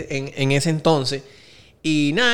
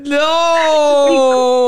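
high, loud cry of disbelief; shocked no; 9 semitones higher, 4 dB louder than usual. Real, not acted. Hearing the boyfriend swallowed the tooth, a loud high shocked no. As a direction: yelp it, horrified.

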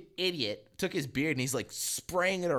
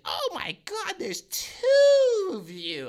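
put-on very high, drawn-out drunk squeal; mock drunk amazement; 7 dB louder, much slower than usual. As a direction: imitate a hammered girl seeing double. Drunk girl sees double.

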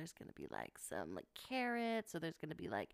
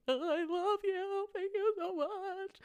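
touched reply; 12 semitones higher than usual. Retold or imitated. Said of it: "and I'm like".

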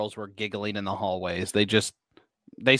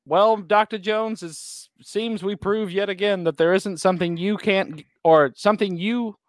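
Belloc's villain line; 5 semitones higher than usual. Retold or imitated. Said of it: "He's like, uh".

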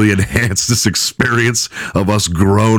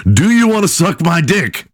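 higher, mocking defendant's retort; defiant defendant; 10 semitones higher than usual. Retelling the handcuffed defendant mouthing off to the judge, higher jeer. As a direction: fling it back like a cocky prisoner.

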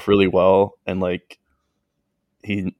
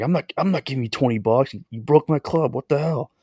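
mock chewing-out; 6 semitones higher than usual. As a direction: imitate an irate golfer telling someone off, pitched up. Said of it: "bitching him out".

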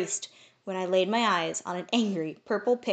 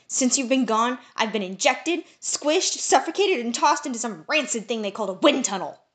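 rising, louder angry list; angry outburst; 4 semitones higher, 6 dB louder than usual. A narrator's reading. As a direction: spit out each injustice, building. Friend angrily lists torture.

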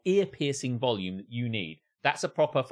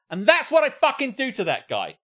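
high, loud, hammed-up threat; vehement outburst; 12 semitones higher, 8 dB louder than usual. Ranting about the theatre whistler, vehement loud threat. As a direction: punch it out as a furious vow.

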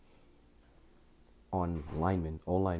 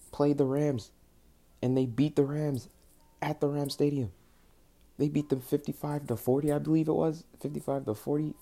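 ranting; 8 semitones higher, 6 dB louder than usual. Real, not acted. Fan ranting about a rival's win.

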